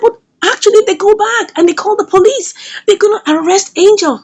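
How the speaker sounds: high-pitched retold warning; alarmed objection. Retold warning of Angel's arrest.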